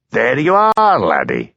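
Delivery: gloating crow with a high swoop on the first words. A narrator's reading. Blair gloating at Hamish, high crowing voice. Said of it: "he crowed".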